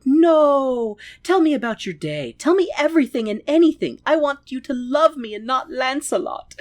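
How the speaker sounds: high, pleading, needy voice; whiny pleading Werther. Retold or imitated. Husband begging his wife for her love, high and needy. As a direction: beg her to stay and love him.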